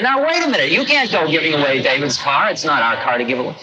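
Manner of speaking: retold flustered objection, starting high; indignant protest. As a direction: stop them from giving the car away. Narrator protests giving car away.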